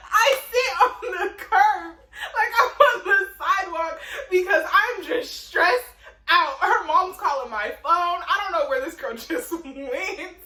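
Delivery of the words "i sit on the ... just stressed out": giggly retelling of a sad night; laughing while telling. Real, not acted. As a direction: laugh at the memory of crying. Laughing while recounting crying.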